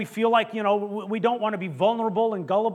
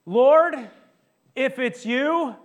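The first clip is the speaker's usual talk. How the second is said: loud, raised, drawn-out call; acted calling out; 6 semitones higher, 8 dB louder, much slower than usual. Peter calling to Jesus from the boat, acted calling out. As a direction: call it across stormy water, slow and high.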